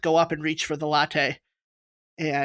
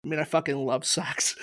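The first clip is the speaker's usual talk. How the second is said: deadpan mumble; 3 semitones lower, 8 dB quieter than usual. Pretend. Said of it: "That's the dragon noise".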